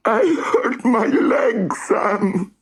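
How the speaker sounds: high, slow tearful complaint; crying. Acted. Rory crying to Sam about his hurt leg. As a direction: sob it out. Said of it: "Rory was crying again".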